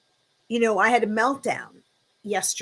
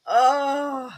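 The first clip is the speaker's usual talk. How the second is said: words lost in real laughter, then a breathless oh; can't stop laughing, trailing into a sigh; 5 semitones higher than usual. Real, not acted. Host laughing at friends' jokes, breathless giggle. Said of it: "you two are killing me".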